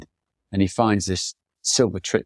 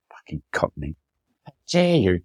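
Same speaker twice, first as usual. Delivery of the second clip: mock indignation. Imitating mum's indignant retort to insult.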